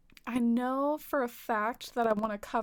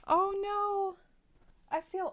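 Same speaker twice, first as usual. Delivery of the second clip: alarmed; 9 semitones higher, slower than usual. Real, not acted. Cat falls off the bed.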